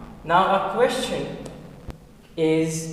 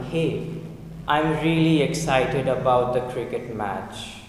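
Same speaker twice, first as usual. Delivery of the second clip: low, unexcited teacher's demo; very low excitement; 5 semitones lower than usual. Shown as a demonstration. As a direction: say it with hardly any excitement. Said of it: "My excitement level is very, very low".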